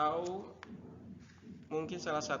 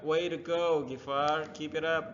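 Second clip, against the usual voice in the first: louder, higher cheering call; enthusiastic cheer; 4 semitones higher, 7 dB louder than usual. Shown as a demonstration. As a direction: cheer the friend on with real excitement. Teacher models cheering.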